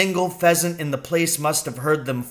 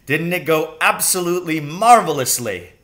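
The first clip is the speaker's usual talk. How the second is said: louder, panting triumph; breathless delight; 4 semitones higher, 6 dB louder than usual. Acted. Father to son after escaping, breathless delight. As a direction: gasp out the joy. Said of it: "breathing heavily".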